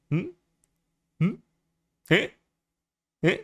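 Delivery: short, rising smug little "eh?" sounds; self-satisfied. Pretend. VTuber showing off thirty-nine viewers, playfully self-satisfied. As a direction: pretend to be pleased with yourself, nudging for approval. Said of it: "if I'm feeling really good about myself".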